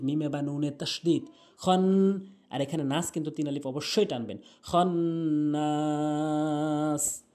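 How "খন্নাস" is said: Quran recitation. Shown as a demonstration. Teacher models the verse in chanted recitation. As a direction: sing the verse with long held vowels.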